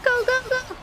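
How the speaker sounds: high, urgent go go go; excited urging. Real, not acted. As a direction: yell them forward, high-pitched. Urging team in fight.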